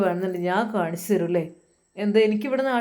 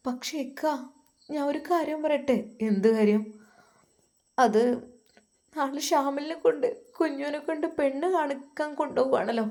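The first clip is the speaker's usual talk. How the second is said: higher, softer voice of a wife who hesitates; a timid wife working up to bad news; hesitant wife; 6 semitones higher, 3 dB quieter than usual.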